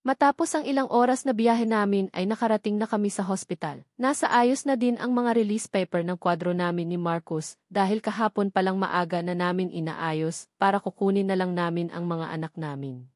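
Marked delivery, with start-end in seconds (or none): none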